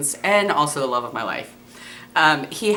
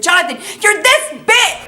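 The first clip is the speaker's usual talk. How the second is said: botched exclamation; 5 dB louder, slower than usual. Retold or imitated. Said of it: "And instead I said".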